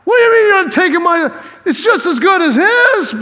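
high, loud mock protest; indignant protest. Cain protesting to God, high and loud.

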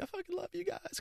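hammed-up overflowing gratitude; gushing self-imitation. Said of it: "of being like".

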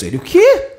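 high, loud startled question; baffled what. Retold or imitated. Man stunned by Pedro's physics jargon, high and loud. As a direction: jolt in total confusion.

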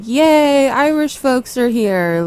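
high sarcastic yay; mock cheer.

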